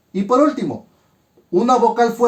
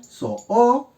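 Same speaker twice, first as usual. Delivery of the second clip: "So, o" as two separate high beats; split into syllables; 5 semitones higher than usual. Shown as a demonstration. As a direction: clear gap between "So" and the second "o". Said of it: "se separan".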